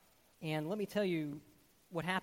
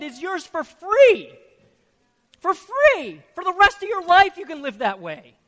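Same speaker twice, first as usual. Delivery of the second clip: loud, higher, urgent preaching; full-force preaching; 11 semitones higher, 16 dB louder than usual. Acted. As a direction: drive it home to the congregation.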